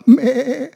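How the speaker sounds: high bleating Mäh; goat bleat. Retold or imitated. The storyteller bleats high for the children.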